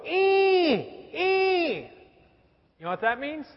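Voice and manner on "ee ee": put-on high "ee, ee" toddler squeal; imitating a toddler's high squeal; baby squeal for bread. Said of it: "she'll go".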